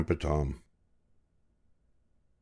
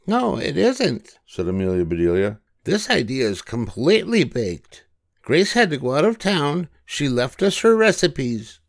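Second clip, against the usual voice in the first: high, cheerful storybook voice for Amelia; Amelia Bedelia voice; 8 semitones higher than usual. A narrator's reading. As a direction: lift into a bright girlish character voice.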